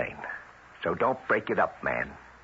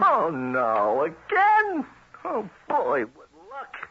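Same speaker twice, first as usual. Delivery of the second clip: a high, loud wail of fake dismay at losing; mock dismay; 5 semitones higher, 3 dB louder, much slower than usual. Card player loses again at gin to the missus, hammed-up dismay. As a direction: groan it up in pretend despair.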